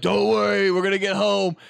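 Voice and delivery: loud reassuring dad. Retold or imitated. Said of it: "he's just like".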